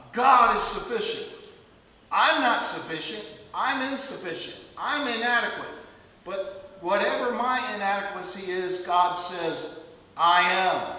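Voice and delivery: fervent sermon climax; rising preaching. Acted. Preaching builds to full force.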